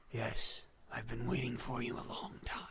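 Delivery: hushed, whispery voice of Death; softly breathed reaper's greeting; solemn Death. Acted. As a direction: whisper it as patient, inevitable Death. Voices Death in game scene.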